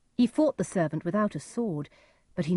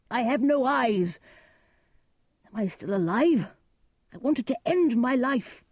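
raised, anguished lament; Gloucester, despairing; 7 semitones higher, 4 dB louder than usual. A narrator's reading. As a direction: protest in despair, voice lifted.

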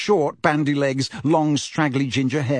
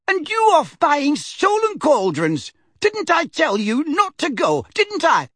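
much higher, louder furious shriek; old lady shrieking in fury; 14 semitones higher, 3 dB louder than usual. Acted. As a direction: scream it at the crook. Figg scolding.

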